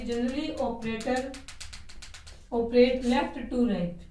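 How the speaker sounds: drawn out, word by word, while writing; slow dictation. Shown as a demonstration. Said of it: "first लिखेंगे यहाँ पे".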